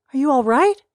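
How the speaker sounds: high, loud worried call; shout. Acted. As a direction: call out anxiously to the back room. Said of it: "Tommy shouted".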